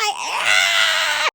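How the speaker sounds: high mock shriek; long hammed-up wail of horror; imitated ancestors' scream. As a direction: scream like appalled forebears. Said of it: "Your ancestors were like".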